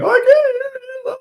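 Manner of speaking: imitated kid's strained scream; mock screaming. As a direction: mimic a kid screaming in panic. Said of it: "the kid was literally screaming".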